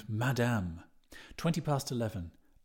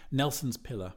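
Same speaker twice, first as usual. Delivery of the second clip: plain reading voice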